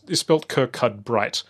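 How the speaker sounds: Kirkcudbright said as written, kirk-CUD-bright; spelling pronunciation. Shown as a demonstration. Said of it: "but it's spelled".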